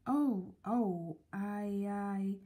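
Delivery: slow, halting apology with broken starts; nervous stammer. A narrator's reading. Mister Good bumps into a stranger, stammering nervously. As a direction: stumble over the words, flustered. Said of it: "stammered Mister Good nervously".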